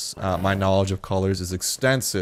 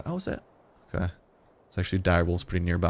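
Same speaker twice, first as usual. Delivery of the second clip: ordinary talk, nothing marked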